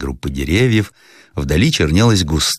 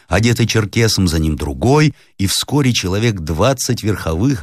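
ordinary delivery

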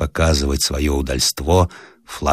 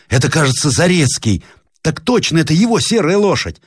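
rising high, anxious cry; alarmed exclamation; 9 semitones higher than usual. Acted. Roslavlev spotting fallen friend, high alarmed cry. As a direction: cry out in sudden fear for a friend. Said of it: "вскричал он".

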